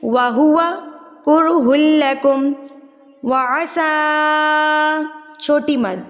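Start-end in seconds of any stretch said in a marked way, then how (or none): 0.0-5.0 heavy ra in كُرْهٌ, slow drawn madd; tajweed recitation. Shown as a demonstration. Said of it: "تو کیسے پڑھیں گے؟". (وَهُوَ كُرْهٌ لَكُمْ میں را کو موٹا پڑھیں)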